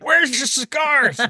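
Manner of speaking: high, drawn-out mock demand; mock demanding customer. Customer asking for cigars at CVS, comically demanding. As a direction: call it out high and slow.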